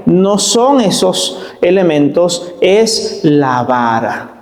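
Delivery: each word weighted, preacher's stress; slow emphasis. Shown as a demonstration. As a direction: lean slowly on es la vara. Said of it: "voy a hacer un énfasis otra vez".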